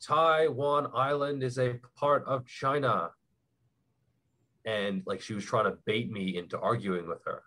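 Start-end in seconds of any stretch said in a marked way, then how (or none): 0.0-3.1 slow, raised-pitch mock yell, no real volume; drawn-out imitation of a classroom declaration; retold student yell. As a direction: imitate a student calling out slowly from the back of class. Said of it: "stood up in the back of the class and yelled".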